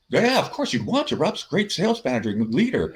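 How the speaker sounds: high, bright overdone enthusiasm; teasing high-pitched yes of course; mock cheerful reply. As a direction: play it up with fake glee. Imitating managers' cheerful reply.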